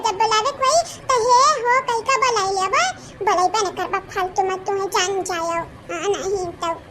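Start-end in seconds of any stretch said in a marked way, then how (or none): none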